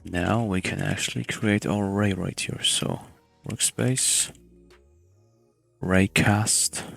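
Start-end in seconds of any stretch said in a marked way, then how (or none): none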